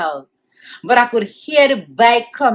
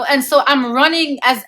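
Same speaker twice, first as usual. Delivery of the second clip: ordinary talk, nothing marked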